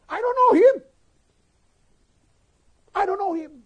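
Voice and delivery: very loud, high-pitched cry of denial; shouted denial. Acted. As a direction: deny it at the top of your voice. (berteriak menyangkal dengan lantang)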